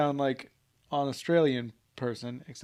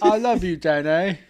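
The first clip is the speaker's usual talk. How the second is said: loud drunken Gump impression; Forrest Gump; 4 semitones higher, 10 dB louder, slower than usual. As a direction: declare love like movie Gump, loudly. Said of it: "start talking like Forrest Gump".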